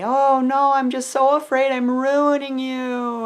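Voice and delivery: high, mock-distressed wail; emotional. Emotional mom confessing fears to sons, mock wail. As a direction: lament it, hammed-up worry. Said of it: "I will be emotional and I will say".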